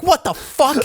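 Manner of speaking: re-enacted high-pitched shocked exclamation; startled outburst. Recalling jumping out of the seat, startled outburst. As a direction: blurt it out high, acting startled.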